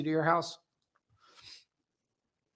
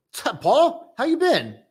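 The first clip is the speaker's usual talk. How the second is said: much higher and louder, a hammed-up hello; goofy greeting; 12 semitones higher, 8 dB louder, slower than usual. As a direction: call out a silly high greeting to a viewer. Greeting a viewer in chat.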